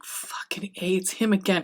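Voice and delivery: hushed, exasperated whisper; whispered complaint. Retold or imitated. Claudia and Louis grumble, hiding from Lestat at the door, in a whisper. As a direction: whisper it so he won't hear at the door. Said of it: "they're like".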